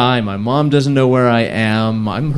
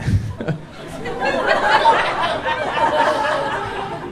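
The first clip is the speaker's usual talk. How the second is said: airy squeaky laugh at himself; breathless high laugh. Real, not acted. Teller cracking up at his own near-death story, breathless laugh.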